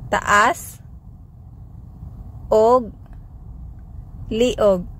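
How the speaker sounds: Taas, og, liog spoken separately with pauses; word by word. Shown as a demonstration. Teacher slowing down the phrase for learners, word by word. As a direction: split it into single words. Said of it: "Sample phrases".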